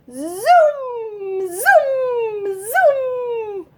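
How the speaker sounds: very high, loud zooms; zooming sound. Acted.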